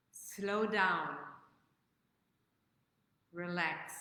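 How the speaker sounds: slow, calm meditation voice with long pauses; guided meditation. Acted. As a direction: ease them into the present, slowly. Leads a breathing meditation.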